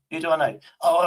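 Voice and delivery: ordinary talk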